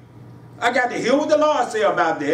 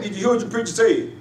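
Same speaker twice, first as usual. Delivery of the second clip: normal talking voice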